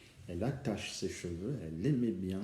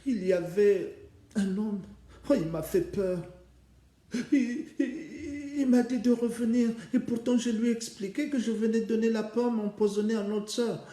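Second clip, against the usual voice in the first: put-on high, frightened child's voice; scared little sister; 12 semitones higher, 7 dB louder than usual. Sister telling her sister about the scary man, high and frightened. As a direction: tell it breathlessly, still spooked.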